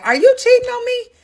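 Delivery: high-pitched, stunned question; shocked accusation. Retold or imitated. Wife confronting husband about trip, high shocked accusation. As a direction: blurt it out in disbelief.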